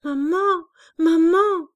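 put-on child calling out; crying out. A narrator's reading. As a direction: call after her mother, desperate. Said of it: "cria encore à deux reprises".

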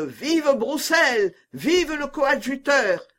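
high, drawn-out acclamation; crowd cheering. A narrator's reading. The people acclaim Broussel and the coadjutor, crowd cheering. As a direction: cheer slowly like a street crowd. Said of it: "Les cris de".

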